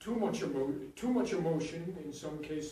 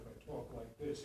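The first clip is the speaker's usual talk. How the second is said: softer, lower, close conversational voice; intimate real-life talk; 5 semitones lower, 8 dB quieter than usual. Shown as a demonstration. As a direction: talk just to the person beside you. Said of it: "if I'm talking to Dan in real life".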